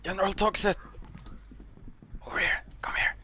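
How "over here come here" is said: whisper. Real, not acted. Sneaking from the ghost.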